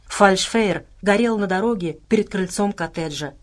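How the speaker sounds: plain reading voice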